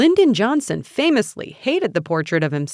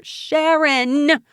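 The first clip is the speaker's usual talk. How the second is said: raised, high call of a name; drawn-out, high shout; 9 semitones higher, 4 dB louder than usual. A narrator's reading. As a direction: shout to someone across the room. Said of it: "I shouted".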